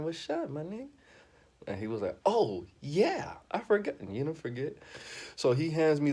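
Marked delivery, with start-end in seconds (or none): 0.0-0.9 high mock voice. Asking host about drugs, high voice. 2.2-4.0 high, bright mock of the host. Comedian imitating the host, pitched way up. Host's reply acted out.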